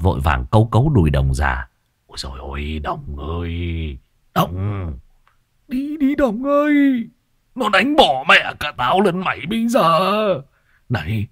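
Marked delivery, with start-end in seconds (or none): 2.1-10.0 put-on old man Phọt whimpering then shrieking high in fright; panicked whine. Phọt begs Đồng in terror, panicked high wail. 10.9-11.3 a hint of a higher, hurried old man's scolding; lightly voiced frantic old man. Phọt hurriedly scolds Đồng, mildly frantic higher voice.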